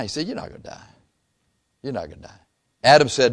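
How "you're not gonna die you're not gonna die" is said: hushed, breathy whisper, much softer; devil's whisper. Acted. Devil whispering to Eve, a soft coaxing whisper. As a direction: coax her softly like a tempter.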